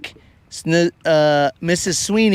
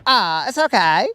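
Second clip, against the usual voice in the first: higher, odd offbeat cheerfulness; a guy happily talking to his fish; mock Colin; 7 semitones higher than usual. Colin shrugging off rejection, hammed-up goofy voice. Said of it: "Colin was all like".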